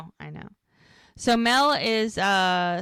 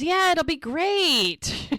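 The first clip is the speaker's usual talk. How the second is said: high, drawn-out gushing in imitation; exaggerated sing-out of fake delight, slowly; mock enthusiasm; 9 semitones higher, 3 dB louder, slower than usual. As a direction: play up the pretend excitement, high and slow. Acted sarcastic-sounding reply, laughs after.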